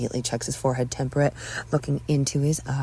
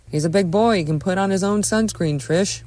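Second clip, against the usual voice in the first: louder, slightly raised protest; defensive husband; 4 semitones higher, 6 dB louder than usual. Acted. Husband defends himself to wife, loud defensive voice. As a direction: push back at your wife, louder.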